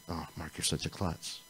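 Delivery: soft mutter of self-reproach; self-scolding. Retold or imitated. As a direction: quietly put yourself down. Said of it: "I'd rather that happen ... than".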